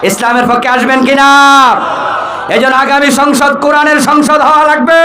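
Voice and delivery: high-pitched full-force exhortation; rallying sermon cry. Real, not acted. Preacher calls crowd to stand for Islam, rallying cry. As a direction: rouse the crowd to answer.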